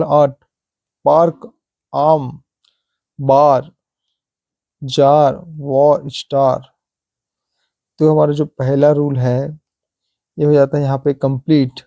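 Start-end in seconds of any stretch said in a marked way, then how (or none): 0.0-6.6 word-by-word drill from "ऑट" to "स्टार", stretching the aa; separate, deliberate example words, "पार्क" to "स्टार", each on a long aa; long aa sound. Shown as a demonstration. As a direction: model the open aa in "पार्क", "बार", "जार", "स्टार". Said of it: "अ की ध्वनि निकल".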